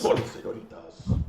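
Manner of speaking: soft, lightly put-on Spanish accent; mock Spanish accent. Echoing the co-host's señoritas joke, in a soft mock Spanish accent. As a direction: say it softly as a mock Spanish charmer. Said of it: "Just turn Spanish for a second".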